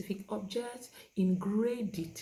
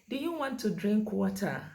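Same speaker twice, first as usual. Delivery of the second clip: loud, higher, full-sentence question; how not to ask; 5 semitones higher, 6 dB louder than usual. Shown as a demonstration. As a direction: fire the whole question at the child. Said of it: "So you don't just say".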